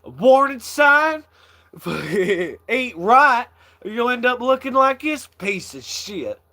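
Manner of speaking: hammed-up country comic outrage; quoting Larry. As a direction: play the rude health inspector. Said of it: "He says".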